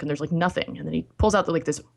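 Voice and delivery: ordinary delivery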